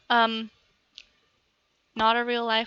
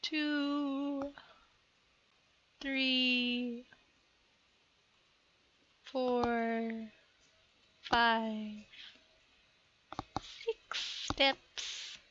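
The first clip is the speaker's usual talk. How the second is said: quiet counting; 8 dB quieter, much slower than usual. Real, not acted. Counting steps in the dark.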